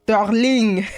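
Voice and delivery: loud, high put-on French accent. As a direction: exclaim it in a playful French accent. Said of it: "say it with your lovely French accent".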